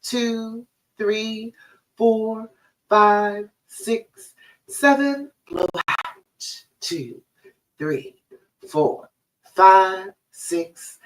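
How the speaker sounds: slow, counted breathing coach voice; guided breathing. Acted. Therapist guiding a client through four seven eight breathing, calm and measured.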